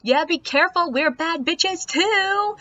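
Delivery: high mock yell, stretched at the end; mock scream. Bachelorette warning the man in the group, mock scream. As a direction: whoop it high like a rowdy bachelorette. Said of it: "the bachelorette screamed out".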